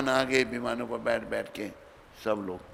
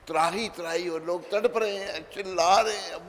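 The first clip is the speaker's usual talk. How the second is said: anguished cry; 6 semitones higher than usual. A narrator's reading. Souls suffering in hell.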